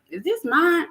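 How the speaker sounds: high-pitched puzzled self-questioning. Acted. Dreamer wondering if the message is theirs, puzzled. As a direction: ask it in a high, doubtful voice.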